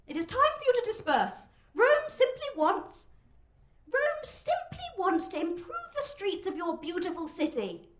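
high-pitched strained public announcement; thin, high official proclamation; weedy, thin shout. A narrator's reading. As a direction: struggle to address an unruly crowd. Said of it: "his delivery is weedy and thin".

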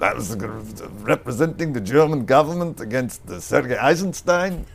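imitating grandfather's German accent; a light German accent, quoting grandfather. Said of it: "it's in his German accent".

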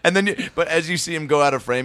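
ordinary delivery

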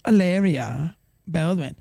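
Hilaria Baldwin in a slow mock Spanish accent. Shown as a demonstration. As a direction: roll out Hilaria Baldwin like a put-on Spanish speaker. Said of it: "she fakes her Spanish accent".

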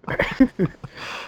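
airy, high-pitched wheezing giggle. Real, not acted. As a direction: giggle breathlessly at your own dark joke. Giggles after dark joke.

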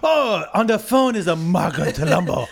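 hammed-up fake Chinese accent; over-the-top Chinese accent caricature; mock Chinese accent. Co-host announcing dreamed caller on the phone, mock Chinese accent.

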